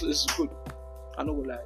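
ordinary talk

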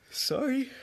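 talking through a yawn. Real, not acted. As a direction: say it mid-yawn. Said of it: "Sorry, I'm yawning".

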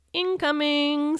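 high, drawn-out warning cry; stretched high-pitched holler; screech. Acted. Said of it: "screeched Kenny".

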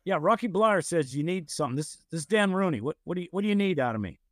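a hint of a blunt boss asking what you want; gruff Dan Rooney. Steelers owner asking the caller what he needs, mildly gruff. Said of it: "I hear this gruff voice going".

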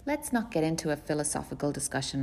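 ordinary delivery, nothing marked

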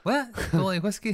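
high-pitched incredulous question; taken aback, pitched well up; surprised. Real, not acted. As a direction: ask it in disbelief, voice jumping up. Astonished that co-host dislikes whiskey.

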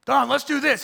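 put-on eager young pastor pitch. Young pastor pitching Don an idea, keen. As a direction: pitch it like an overexcited rookie. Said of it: "stupid ideas when I first got here".

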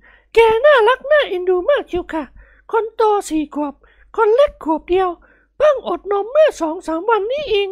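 very high, gushing falsetto; doting smiling mother. Acted. Lady describing her toddler daughters, doting falsetto. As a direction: coo proudly about little ones. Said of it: "หล่อนพูดยิ้มยิ้ม".

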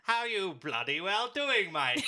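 loud, high, drawn-out mock Aussie; hammed-up Australian accent, slow and loud. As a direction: belt out an over-the-top Australian greeting. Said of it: "give me something to say in Australian".

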